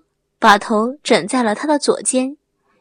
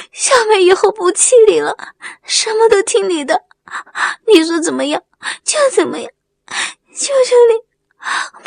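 high-pitched, tearful begging through sobs; sobbing plea; 8 semitones higher than usual. A narrator's reading. Wang Mei begs Erlengzi to come back, sobbing. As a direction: cry and beg him, voice pitched well up. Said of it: "哭泣地说".

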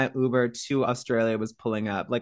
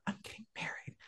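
awed whisper to herself; 19 dB quieter than usual. Retold or imitated. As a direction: breathe it in disbelief. Inner thought whispered.

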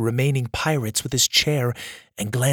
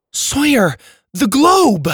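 urgent call; 11 semitones higher, 6 dB louder, slower than usual. Acted. Susie urgently calls to Sawyer.

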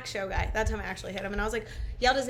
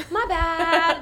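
sheepish apology; 5 semitones higher, 7 dB louder than usual. Retold or imitated. Retold sheepish apology next morning.

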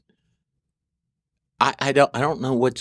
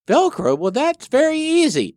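naive voice. Retold or imitated. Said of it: "they're like".

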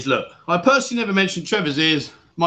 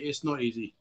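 much softer, trailing off to himself; quiet repeat; 5 semitones lower, 11 dB quieter than usual. Real, not acted. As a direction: drop it down, almost under breath.

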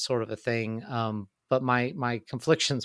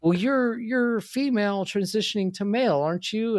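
high-pitched, puzzled mimicry; imitated old man; 9 semitones higher than usual. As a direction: mimic a confused questioner in a much higher voice. Imitates confused old man explaining himself.

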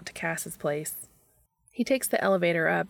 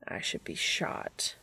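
much lower, soft, slow grave murmur; serious; 11 semitones lower, 6 dB quieter, slower than usual. A narrator's reading. Castiel to Dean, mock-grave, low and hushed. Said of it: "he says seriously".

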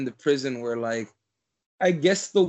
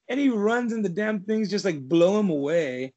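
ordinary talk, nothing marked

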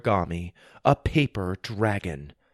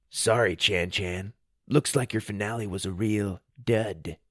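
smug taunt; 3 semitones lower, 7 dB quieter than usual. Acted. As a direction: gloat quietly at him. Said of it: "impressed with himself".

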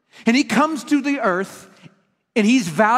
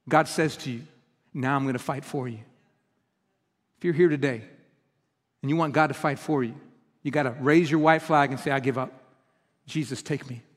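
lower, much softer, intimate; hushed altar call; 9 semitones lower, 6 dB quieter than usual. Acted. Preacher invites listeners to surrender, lower and much softer. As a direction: draw them in gently toward surrender.

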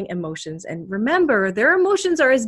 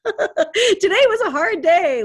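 laughing; 9 semitones higher, 4 dB louder, slower than usual. Acted. Venting about a hard day.

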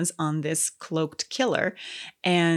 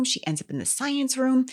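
ordinary talk, nothing marked

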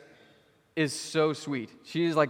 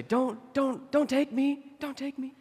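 put-on high, pleading little-girl voice; imitating a small child begging; pleading toddler; 13 semitones higher, slower than usual. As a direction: beg like a frightened toddler. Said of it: "and she would say".

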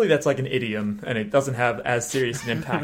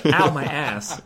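retold yell; 4 semitones higher, 3 dB louder, slower than usual. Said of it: "when he yelled in English".

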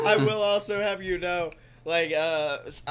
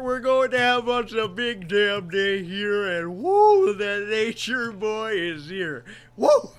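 Ric Flair voice; 5 dB louder, slower than usual. Pretend. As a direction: hype it like a wrestling promo with big woos. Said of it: "the other side says Flair".

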